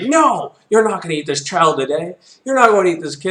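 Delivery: loud, high-pitched defiance; heroic lumberjack shout. Acted. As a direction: yell the wolf down. Lumberjack confronts wolf.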